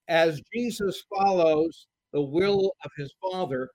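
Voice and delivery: normal talking voice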